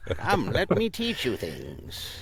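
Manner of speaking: high, very slow teacher voice; old master. Acted. Guest auditioning as Master Splinter, high slow old master. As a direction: stretch it out as an ancient mentor. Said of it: "I'm an old master".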